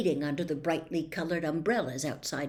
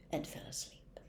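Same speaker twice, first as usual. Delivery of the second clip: whispered; 15 dB quieter than usual. A narrator's reading. Harry drifting off to sleep.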